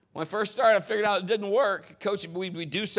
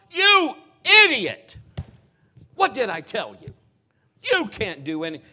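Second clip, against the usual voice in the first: loud scolding father; mock angry scolding; 3 semitones higher, 10 dB louder than usual. Father scolding daughter who fell, hammed-up anger. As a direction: yell at her as a counter-example. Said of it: "if I would have said to Mal".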